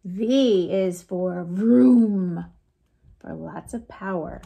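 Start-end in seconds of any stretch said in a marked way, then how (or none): none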